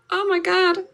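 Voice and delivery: high-pitched mock-panicked exclamation; retold dismay. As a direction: act out last-minute dread, voice jumping up. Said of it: "I'm just like".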